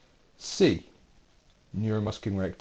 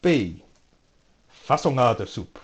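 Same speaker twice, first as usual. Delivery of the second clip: mock Greek accent, louder; 6 dB louder than usual. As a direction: roll out fasolada soup in a put-on Greek accent. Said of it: "Don't know why I have to do the accent".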